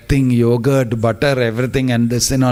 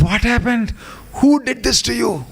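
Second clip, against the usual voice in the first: higher, alarmed cry of a frightened father; worried father calling out; 11 semitones higher than usual. Acted. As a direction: call out in a panic for your son. Father finds son between fallen trees.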